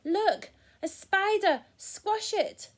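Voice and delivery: high, loud cry of alarm; mock scream. A narrator's reading. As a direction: shriek it for a children's story. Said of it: "screamed someone".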